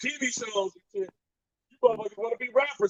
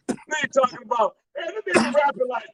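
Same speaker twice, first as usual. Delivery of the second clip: ordinary delivery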